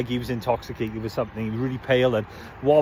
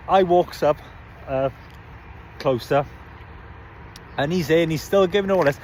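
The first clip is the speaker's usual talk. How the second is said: ordinary delivery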